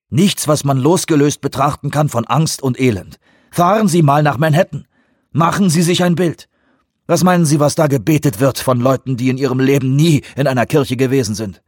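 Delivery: heated challenge to a colleague; Peake, impassioned. Acted. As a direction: drive the grim point home insistently.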